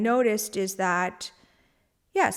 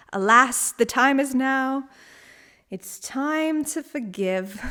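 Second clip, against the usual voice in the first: mock dramatic; 5 semitones higher, 4 dB louder, slower than usual.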